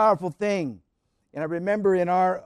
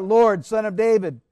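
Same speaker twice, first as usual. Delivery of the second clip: louder pleading cry; raised plea for mercy; 7 dB louder than usual. Acted. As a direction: call out to the Son of David. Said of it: "she cried out".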